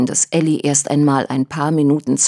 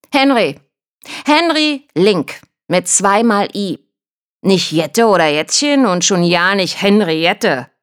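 higher, louder call booming down the stairwell; trumpeting call; 4 semitones higher, 4 dB louder than usual. Acted. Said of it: "trompetete es durchs Treppenhaus".

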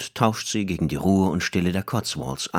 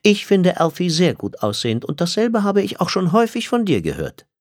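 tag; 10 semitones higher, 3 dB louder than usual. Acted. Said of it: "sagte Liz".

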